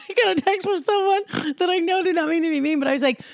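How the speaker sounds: quick, high, laughing delivery. Real, not acted. Recalling a text not meant to be mean, laughing. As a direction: tell it fast through real laughter. Laughs while telling the anecdote.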